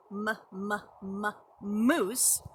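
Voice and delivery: "moose" after separate, repeated "m" sounds; first sound repeated. Shown as a demonstration. Teacher sounding out a word for preschoolers, first sound repeated. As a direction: bounce on the m before saying "moose". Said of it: "M words".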